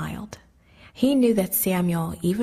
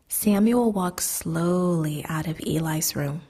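ordinary delivery

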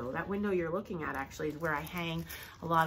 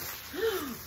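high gasp of surprise; delighted gasp; 10 semitones higher than usual. Real, not acted. Collector unwrapping a pretty dish, delighted gasp.

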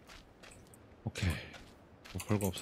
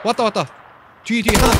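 high urgent yelling; alarmed shout; 10 semitones higher, 10 dB louder than usual. Real, not acted. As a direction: shout the warning to your teammate. Enemies arriving, calling out alarm.

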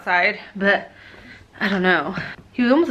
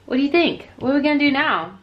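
normal talking voice

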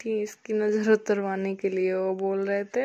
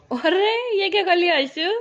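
surprised; 8 semitones higher, 6 dB louder than usual. Real, not acted. Baby did something unexpected. (हैरानी से ऊँची आवाज़ में)

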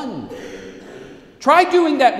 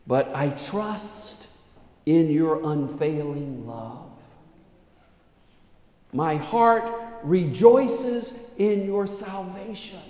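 psalm of trust; 4 dB louder than usual. Acted. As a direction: begin hushed, build to joyful praise. Reads psalm's hopeful ending.

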